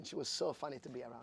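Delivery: ordinary talk, nothing marked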